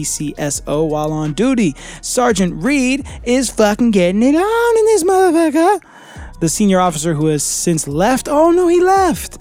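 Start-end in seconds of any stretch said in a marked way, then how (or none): 2.0-5.8 high, loud gleeful hype; excited. Real, not acted. Excited gossip. 8.3-9.4 high, slow mock gasp of dismay; mock shocked.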